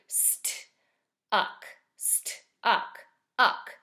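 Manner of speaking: st and uck split apart, st breathed; sounding out parts. Shown as a demonstration. Teacher to first graders, sounding out st and uck. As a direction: break stuck into st and uck. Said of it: "So listen for parts like that too".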